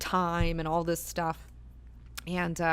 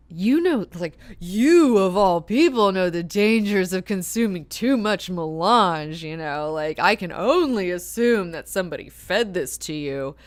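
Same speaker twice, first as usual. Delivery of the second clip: loud, slow, scolding lecture; a doctor scolding a patient who overdosed, loud; admonishing; 8 dB louder than usual. Retold or imitated. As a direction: scold her like a stern doctor. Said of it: "the doctor, like, admonishes her".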